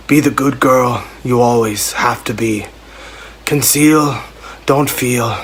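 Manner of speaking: brooding northern English reading of pop lyrics. Pretend. Reading Let It Go lyrics as Jon Snow, lightly voiced northern English. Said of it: "reading lyrics from Let It Go as Jon Snow".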